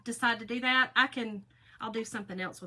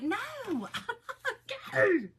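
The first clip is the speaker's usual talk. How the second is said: laughing; 12 semitones higher, 3 dB louder, faster than usual. Retold or imitated. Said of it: "I'm like".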